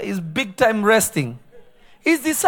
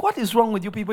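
usual speaking voice

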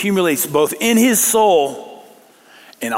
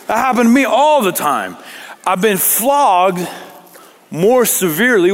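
high, raised declamation with weighted words; impassioned recital; 6 semitones higher, 4 dB louder than usual. Acted. Paul listing his floggings to his critics, impassioned recital. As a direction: proclaim each hardship forcefully.

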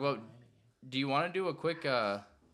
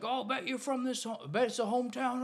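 retold heckler's yell; 11 semitones higher than usual. Umpire retelling a heckler screaming at him, mock yell. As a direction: imitate a heckler hollering in a raised pitch. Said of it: "screaming at me".

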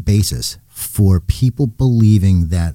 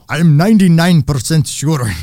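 put-on light Russian accent, a touch higher; 6 semitones higher than usual. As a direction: quote him in a mild Russian accent. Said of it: "See, you can do Russian accent".

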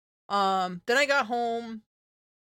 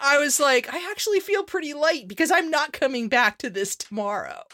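excited relief; 7 semitones higher, 5 dB louder than usual. Real, not acted. Happy relief at going part-time.

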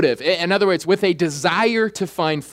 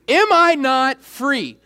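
"Am I not free" drawn out slowly, pitched high; emphatic slow repeat; 8 semitones higher, slower than usual. Shown as a demonstration. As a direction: stretch out each word of "Am I not free".

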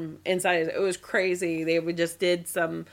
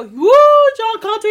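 cheering; 13 semitones higher, 14 dB louder than usual. Retold or imitated. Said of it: "cheer him on".

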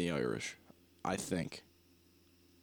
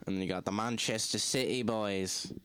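mock Manchester accent. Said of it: "Is that how? ... the pitch in their voice".

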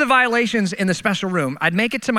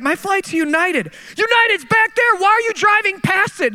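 exasperated protest; 10 semitones higher than usual. Retold or imitated. Driver protesting to airport worker, high exasperated pleading. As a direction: throw up your hands at the absurdity.

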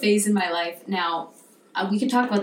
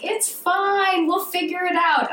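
high-pitched, breezy imitation of someone reassuring; placating Greek woman; 9 semitones higher than usual. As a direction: voice her brushing it off, pitched way up. Quoting Greek woman placating the speaker.

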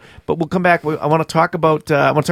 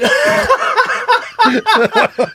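loud high laugh; 4 dB louder than usual. Real, not acted. Big laugh after banter.

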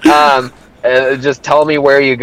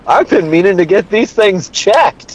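laughing; 9 semitones higher than usual. Pretend. Joking, laughing.

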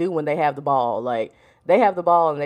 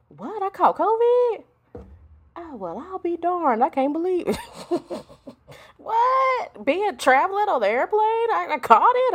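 mock astonishment; 11 semitones higher than usual. [name] back from Kenya learning she caught covid, mock astonishment.

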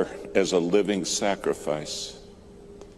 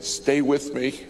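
rising, high insistent plea; pleading preaching; 7 semitones higher than usual. Acted. Preacher echoing the controller's plea to the congregation, building higher. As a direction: build the plea with each repeat.

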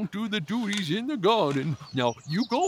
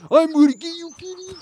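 excited chase; 12 semitones higher, 10 dB louder, faster than usual. Acted. Dog chasing the cat.

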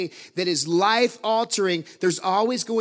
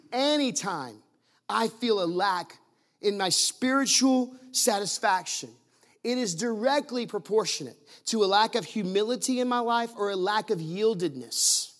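slower, spacing each phrase apart; slow and deliberate. Shown as a demonstration. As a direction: lay every word down slowly for emphasis. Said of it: "Can I say that again? This is me telling you".

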